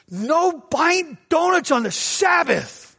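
high, slow, prissy forbidding; prim scold. Retold or imitated. Date forbids donuts on Sabbath, high prissy slow voice. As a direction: sniff out the rule, high and slow. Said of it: "she says".

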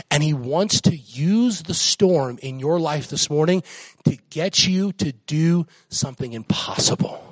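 ordinary delivery

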